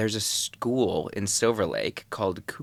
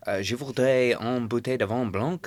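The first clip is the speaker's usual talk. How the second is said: mock-fancy French order with an accent; put-on French accent; 4 semitones higher than usual. Tourist ordering wine in Paris, hammed-up French accent. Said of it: "I would do a voice".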